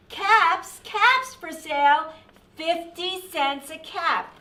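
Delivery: high, loud peddler's cry; street vendor's call. A narrator's reading. Peddler walking back to town, calling his caps. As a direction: call out wares to passers-by. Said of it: "he walked back to town calling".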